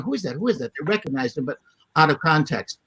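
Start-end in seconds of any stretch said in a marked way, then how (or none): 0.0-0.7 murmured question. Retold or imitated. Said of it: "people were going".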